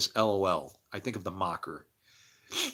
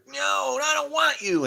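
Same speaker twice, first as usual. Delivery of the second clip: mock refusal; 4 dB louder than usual. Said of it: "acting out a resisting listener".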